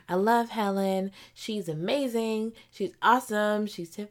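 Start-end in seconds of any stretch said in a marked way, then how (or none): none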